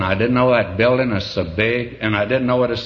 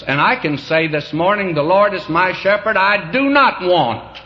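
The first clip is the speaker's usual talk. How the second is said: raised, ringing pulpit proclamation, slowing at the end; preaching at full force; 7 semitones higher, 3 dB louder than usual. Acted. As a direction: declare it high and loud like a testimony. Preacher proclaims this morning's testimony.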